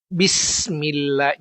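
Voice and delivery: Bismillah slow, sin flowing straight into mim; correct pronunciation. Shown as a demonstration. Teacher showing students the smooth, correct way. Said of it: "Tidak ada patahan di situ ya".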